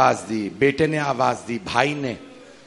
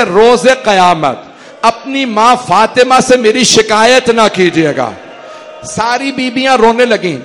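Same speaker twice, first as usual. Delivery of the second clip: louder, higher grieving recitation, building; rising majlis lament; 5 semitones higher, 7 dB louder than usual. Acted. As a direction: let the lament swell toward tears. Masaib climax, Hur addresses the ladies. (بلند ہوتی ہوئی پر سوز مصائب خوانی)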